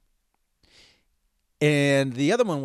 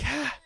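winded gasp; 13 semitones higher than usual. Retold or imitated.

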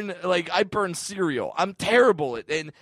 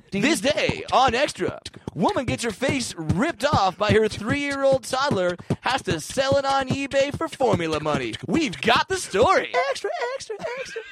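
tabloid TV host; 10 semitones higher than usual. Retold or imitated. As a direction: overblown entertainment news intro. Parody of Extra anchor.

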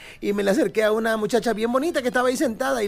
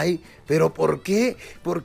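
friendly monster voice; 4 semitones lower, slower than usual. Acted.

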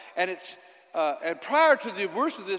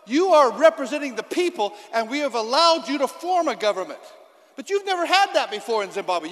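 loud, driving sermon voice; forceful preaching; 3 semitones higher, 7 dB louder than usual. Acted. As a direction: preach it hard to the congregation.

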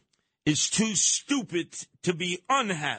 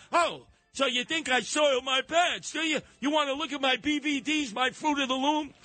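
higher, hammed-up indignant Putin; mock Putin; 8 semitones higher than usual. Host quoting Putin daring critics, hammed-up indignant. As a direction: dare them to check, half-joking. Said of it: "He said".

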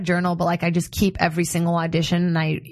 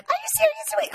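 high disbelieving squeal. Retold or imitated. Disbelieving retold reaction.

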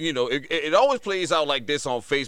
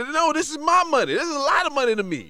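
indignant insistence; 12 semitones higher, 3 dB louder than usual. Retold or imitated. Dad insisting to son.

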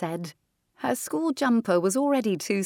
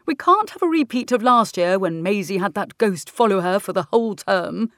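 louder, quick stern teacher; 2 semitones higher, 7 dB louder than usual. Acted. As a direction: lecture like an exasperated teacher. Teacher scolding about the ghost.

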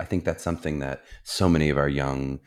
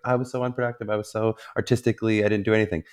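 a mock wail, lightly voiced; higher, whining about being unproductive; playful impression of a friend moaning; imitating Elizabeth; 6 semitones higher, faster than usual. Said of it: "she'll just be like".

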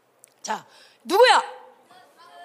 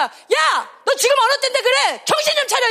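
high, urgent scolding; indignant preacher's rebuke; acted confrontation; 9 semitones higher than usual. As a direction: shake a friend awake with words. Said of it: "가서 얘기하는 거야".

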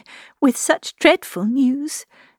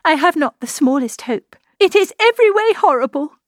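high, anguished hopelessness; distraught, pitched high and swelling at the end; despairing; 8 semitones higher, 3 dB louder than usual. A narrator's reading. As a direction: lament that all is lost. Despairing outburst over the elopement.

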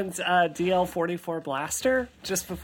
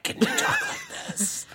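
Batman voice. Shown as a demonstration. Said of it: "talk in a Batman voice".

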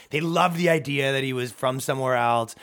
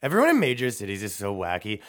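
ordinary talk

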